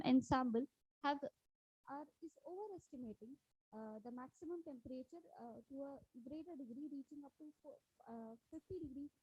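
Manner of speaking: fading out on a bad line; barely audible. Real, not acted. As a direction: voice dropping far away. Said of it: "I think your voice is very low now".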